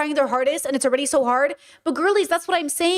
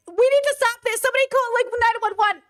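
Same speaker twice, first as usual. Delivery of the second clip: mock panic; 10 semitones higher than usual. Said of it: "And I was like".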